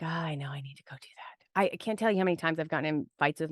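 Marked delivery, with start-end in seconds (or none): 0.0-1.5 soft unvoiced whisper of a sudden knowing; a hushed inner voice arriving. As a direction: breathe it like a private realisation.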